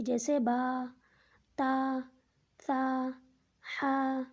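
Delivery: light, open-mouthed letters; thin letters. Shown as a demonstration. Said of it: "حرف کی آواز باریک نکلے گی". (باریک آواز میں)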